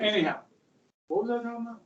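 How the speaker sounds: usual speaking voice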